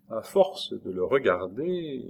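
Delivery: plain reading voice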